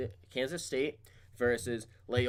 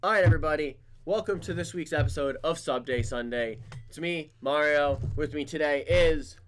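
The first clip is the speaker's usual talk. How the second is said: loud, upbeat host opener; show host intro; 3 semitones higher, 5 dB louder than usual. Acted.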